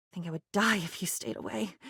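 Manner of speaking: a quick, near-silent admission; soft confession. Acted. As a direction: let it slip out under the breath.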